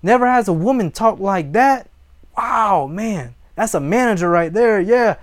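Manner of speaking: acted-out wowed admirer; imitating amazed onlookers. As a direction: play an impressed bystander, voice lifted. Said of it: "People gonna look around and go".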